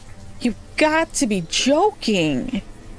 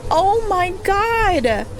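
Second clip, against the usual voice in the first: disbelief; 9 semitones higher, 4 dB quieter than usual. Real, not acted. Tornado kills colonists.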